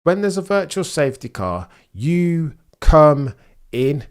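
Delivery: worked-up, high-pitched complaint; exasperated rant. Real, not acted. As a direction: hammer it out in loud frustration. Ranting at the pit call.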